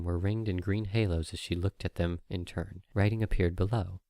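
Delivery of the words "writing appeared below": plain reading voice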